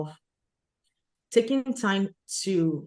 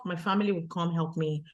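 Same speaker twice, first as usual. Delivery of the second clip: ordinary delivery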